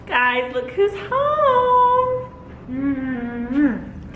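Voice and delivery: high, slow sing-song greeting; welcoming someone home. Real, not acted. Dogs arrive home.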